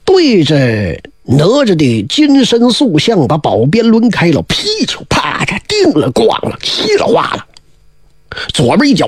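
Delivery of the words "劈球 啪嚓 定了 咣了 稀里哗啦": high, hissing crash sounds; mock smashing noises. A narrator's reading. Storyteller imitating the statue crashing to pieces, high crash sounds. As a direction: spit out the shattering like a breaking statue.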